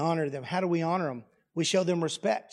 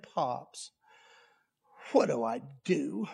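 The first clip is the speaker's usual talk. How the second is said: put-on lower, softer little girl's plea; small child plea; 4 semitones lower, 5 dB quieter, slower than usual. Granddaughter with head in grandpa's lap: soft helpless plea. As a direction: sound helpless and frustrated.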